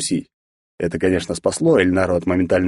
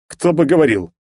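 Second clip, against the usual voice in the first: mocking; 7 semitones higher, 3 dB louder than usual. A narrator's reading. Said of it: "насмешливо хмыкнул я".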